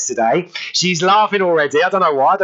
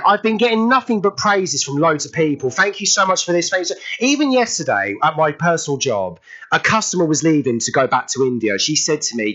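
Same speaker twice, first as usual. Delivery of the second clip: ordinary delivery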